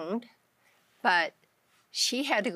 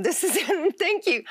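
laughing with joy; 11 semitones higher, 5 dB louder than usual. Retold or imitated. Joy at answered prayer retold.

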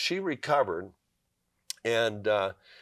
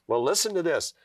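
normal talking voice

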